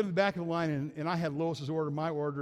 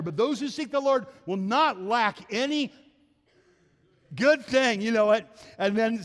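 raised, ringing pulpit voice; fervent preaching; 5 semitones higher, 4 dB louder than usual. Acted. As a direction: preach it out to the congregation, full conviction. Preaching full force on God's goodness.

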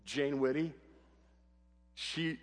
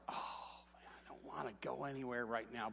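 half-whispered, much softer grumble; inner grumble; 3 semitones lower, 14 dB quieter than usual. Retold or imitated. Dad grumbling to himself about a late errand, under breath. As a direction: mutter it to yourself, unwilling. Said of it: "And I was like".

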